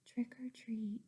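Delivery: whispered trick or treat; boy asking for candy very softly. Acted. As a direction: barely breathe the words. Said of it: "so he said very softly".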